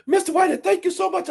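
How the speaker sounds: imitating Susan. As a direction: play the stunned employee on the phone. Susan voiced in story.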